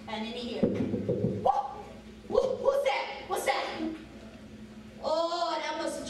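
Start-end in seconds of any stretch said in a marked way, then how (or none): 1.4-3.6 put-on startled old man. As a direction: jump at the noise. Startled by ghostly noise.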